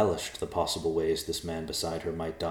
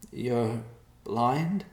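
rising disbelief on blind; unbelieving; 6 semitones higher than usual. Acted. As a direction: ask in stunned disbelief.

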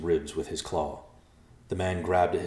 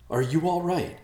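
high-pitched, worried question; urgent, high check on someone hurt; concerned; 11 semitones higher, 3 dB louder than usual. A narrator's reading. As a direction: ask anxiously, pitched up. Thomas checks on injured sister.

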